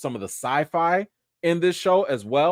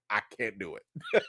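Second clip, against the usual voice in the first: laughing give-up; 7 semitones lower, 10 dB quieter than usual. Retold or imitated. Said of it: "I would have been like".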